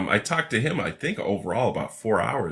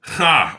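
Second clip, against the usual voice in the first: short laugh; 8 semitones higher, 8 dB louder than usual. Real, not acted. Laughing at the whack job.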